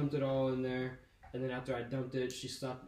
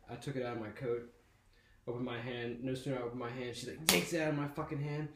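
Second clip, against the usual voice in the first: usual speaking voice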